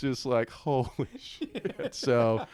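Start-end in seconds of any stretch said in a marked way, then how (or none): 0.6-1.9 stunned swear. Retold or imitated. Coach stunned seeing record shooting.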